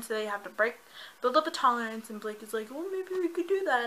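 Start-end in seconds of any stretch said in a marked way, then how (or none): 2.7-3.8 high-pitched mock suggestion; mocking imitation. Reader mimicking the disliked Blake, mocking imitation.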